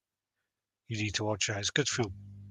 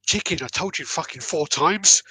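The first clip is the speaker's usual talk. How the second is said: higher, louder fed-up answer; exasperated retort; 7 semitones higher, 5 dB louder than usual. Retold or imitated. Wife shouts back at husband in annoyance. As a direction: snap back at him, irritated.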